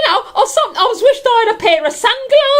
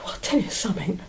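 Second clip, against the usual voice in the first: whisper; 13 semitones lower, 13 dB quieter, faster than usual. Acted. Confiding aside to audience.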